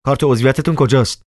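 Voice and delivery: insist, clipped and quick; repeated demand. Acted. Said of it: "زن تکرار کرد".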